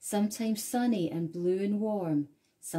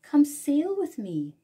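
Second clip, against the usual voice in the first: higher and louder, inviting; whale's invitation; 7 semitones higher, 6 dB louder than usual. A narrator's reading. Whale inviting the snail aboard, welcoming. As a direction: call her aboard kindly. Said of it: "and said to the snail".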